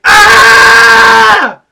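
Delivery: high, drawn-out screamed outburst; hammed-up rage at full volume; pissed-off songwriter. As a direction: scream like an interrupted, furious writer. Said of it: "John just got pissed while writing".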